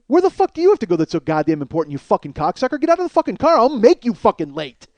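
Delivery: building from heated question to high, loud threat; angry tirade. Retold or imitated. Man threatens passenger who complained of waiting, angry yelling. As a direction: let it climb into yelling. Retells his own furious comeback.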